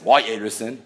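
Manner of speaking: normal talking voice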